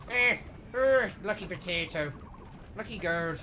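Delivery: loud, high, hammed-up Irish accent; mock Irish accent. Streamer mocks Irish chat with a hammed-up Irish accent. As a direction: rattle it off like a stage Irishman. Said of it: "that's my impersonation of what an Irishman is".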